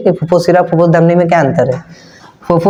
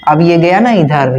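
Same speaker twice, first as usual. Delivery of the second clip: ordinary talk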